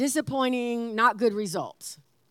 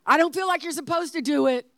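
higher-pitched, insistent demonstration; straight, emphatic warning; 6 semitones higher, 4 dB louder than usual. Shown as a demonstration. Wife modelling the warning she expects, straight and emphatic. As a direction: spell the warning out firmly. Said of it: "I say it real straight".

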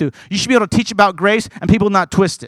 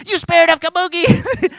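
much higher, hammed-up rebuke cry; high mock rebuke; 11 semitones higher than usual. Preacher mimics rebuking the spirit, high and hammed-up. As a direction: shriek the rebuke in jest. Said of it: "He goes".

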